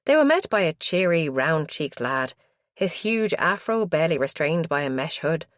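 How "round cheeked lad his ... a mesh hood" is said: ordinary delivery, nothing marked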